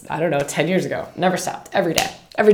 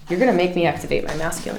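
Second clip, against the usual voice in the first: usual speaking voice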